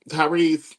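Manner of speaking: usual speaking voice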